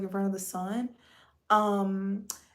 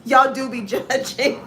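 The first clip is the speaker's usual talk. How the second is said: laughing tease; 3 semitones higher, 7 dB louder than usual. Real, not acted. Teasing chat while laughing.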